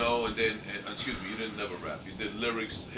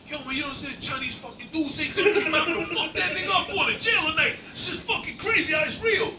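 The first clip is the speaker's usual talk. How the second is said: high, loud, worked-up caller; retold frantic yelling; 13 semitones higher, 5 dB louder than usual. Friend ranting on the phone about a fight, mock yelling. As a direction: yell it high, mimicking a riled-up friend. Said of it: "what are you yelling at me".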